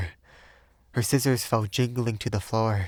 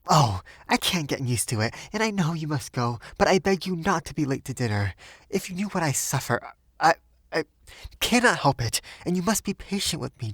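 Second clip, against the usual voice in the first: higher, rushed, near tears; distraught begging; 7 semitones higher, faster than usual. A narrator's reading. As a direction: plead in a tumbling rush. Sylvia breaking down, begging.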